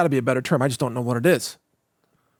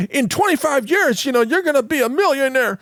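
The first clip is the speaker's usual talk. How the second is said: high-pitched mocking sales promise; mock financial planner; 9 semitones higher than usual. Host mimicking planners promising future millions, high mocking voice. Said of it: "all their plans are like".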